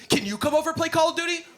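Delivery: put-on high, quick eager child; squeaky-high kid asking hopefully; kid on the street. Voices neighbor kid asking.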